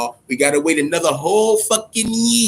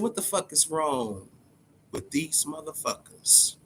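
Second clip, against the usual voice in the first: much softer, muttered-aside disbelief; hushed disbelief; 11 dB quieter than usual. Retold or imitated. Reacting in private to covid parties, hushed and disbelieving. Retelling his own reaction.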